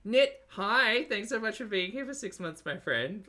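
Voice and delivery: high, bright sing-song thanks; excited greeting. Real, not acted. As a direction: greet a friend with delight. Sing-song thanks to chat member.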